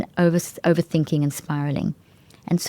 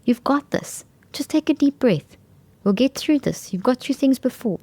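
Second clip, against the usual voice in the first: higher, gentle reassuring voice; kind self-talk; 7 semitones higher than usual. Shown as a demonstration. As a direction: soothe yourself like a kind friend. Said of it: "Rather say something like".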